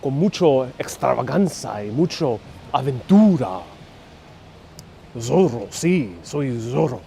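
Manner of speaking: theatrical Spanish soap-opera flourish; telenovela impression. Streamer showing viewers his telenovela act, theatrical. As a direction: play a melodramatic telenovela hero in Spanish. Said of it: "That's my telenovela".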